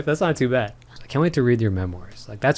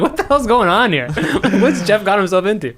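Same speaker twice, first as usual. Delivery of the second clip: laughing; 7 semitones higher than usual. Retold or imitated. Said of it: "I'm like".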